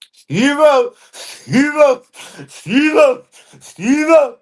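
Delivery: high, loud squawked name call. Pretend. Repeatedly calling out to Steve-O.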